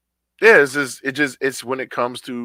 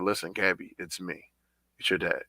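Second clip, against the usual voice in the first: softer, lower, gentle coaxing; reassuring; 4 semitones lower, 5 dB quieter than usual. Retold or imitated. Dad reassuring his upset daughter, gentle and soft. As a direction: ease her into talking. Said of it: "I reassure her".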